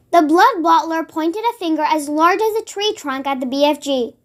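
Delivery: ordinary delivery, nothing marked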